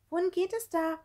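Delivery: high-pitched, excited little voice; excited question. A narrator's reading. Gerti asks where the passage goes, high excited voice. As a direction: ask it high and agitated. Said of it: "fragte Gerti aufgeregt".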